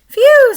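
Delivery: high, loud relieved "Phew". A narrator's reading. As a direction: blow out relief. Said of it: "said Helen".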